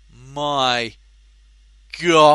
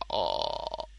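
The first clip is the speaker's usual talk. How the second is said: gobsmacked; 13 semitones lower, 11 dB quieter than usual. Retold or imitated. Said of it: "gobsmacked expression is like".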